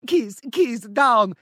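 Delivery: high-pitched "keese keese down", Italian-style; mock Italian accent. Host ridiculing the caller's story, mock Italian accent. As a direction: send up a bad Italian accent. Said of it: "You can't do the accent".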